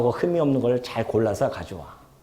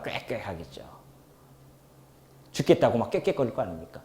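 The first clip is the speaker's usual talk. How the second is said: breathy whispered aside; 4 semitones lower, 8 dB quieter than usual. Real, not acted. Preacher imagining the dying animal's cries, whispered. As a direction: drop to a bare whisper.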